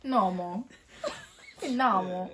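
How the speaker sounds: "Normal" in a laughed, half-failed American accent; attempted American accent. Shown as a demonstration. Partner failing to say normal like an American, attempted American accent. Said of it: "Say normal in an American accent".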